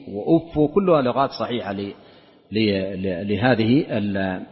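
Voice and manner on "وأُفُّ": وأف with doubled f and a bare "u" ending; damma without tanween. Shown as a demonstration. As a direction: pronounce وأف as "wa-uffu". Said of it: "وضمها وكسرها بتنوين وبغير تنوين".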